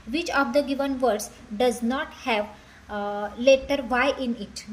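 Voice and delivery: each English word spaced out very slowly; slow dictation reading. Shown as a demonstration. Teacher reading the question to students, very slowly.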